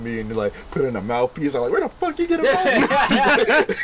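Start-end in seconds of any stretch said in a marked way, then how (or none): 1.7-3.8 slow, high-pitched incredulous question; mock disbelief. Grown man seeing kid's mouthpiece, mock disbelief.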